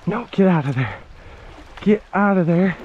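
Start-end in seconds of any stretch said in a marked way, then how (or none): none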